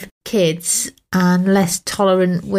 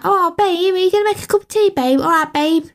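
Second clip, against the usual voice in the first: higher mocking Essex voice; Essex babe; 8 semitones higher than usual. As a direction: send up the babe talk in Essex. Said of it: "You're like".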